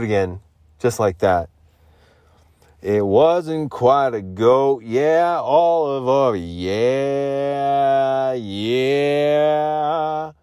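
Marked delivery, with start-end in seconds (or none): 2.8-10.3 slow, higher put-on goofy voice; silly drawn-out voice. Streamer drawling to chat in a goofy voice. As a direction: stretch the yeahs in a silly character voice.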